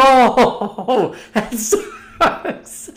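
high, retold through laughter; helpless laughing groan of pity; laughing sympathy. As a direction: crack up while acting out the reaction. Retold reaction to buddy's laughing.

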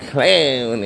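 slow proud drawl; slow showman's drawl; drawn out. Real, not acted. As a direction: stretch every word out slowly. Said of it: "Your neighborhood clown".

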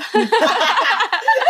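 high, loud cackle of laughter; burst of laughter. Real, not acted. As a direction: crack up at your own prank. Laughs at her own prank story. (tertawa terbahak-bahak)

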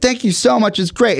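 mock wife. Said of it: "she's like".

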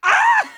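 sudden very high, loud shriek; startled mock scream. Painter seeing a worm pop out, startled mock scream. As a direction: imitate a horrified scream at full pitch. Imitating Basil's shock at the worm.

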